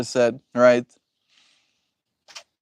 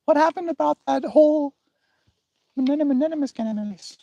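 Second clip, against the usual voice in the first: high put-on voice mocking a chat user; imitating chatter. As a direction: squeak it out like a nosy viewer. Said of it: "People that talk in all caps".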